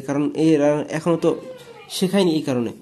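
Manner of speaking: normal talking voice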